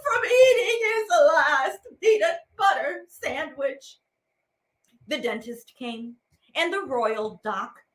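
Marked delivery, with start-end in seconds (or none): 0.0-4.0 hammed-up sobbing wail, higher and louder; mock wailing mother. Narrator voices the mother wailing over her son. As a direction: wail it like a grieving mother, half laughing. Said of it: "his mother cried".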